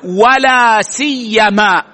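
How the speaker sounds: لا سيما slow, the ي doubled; teacher models لا سيما with a geminated ي; pronunciation demo. Shown as a demonstration. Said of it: "التشديد والتخفيف". (لا سيما بتشديد الياء)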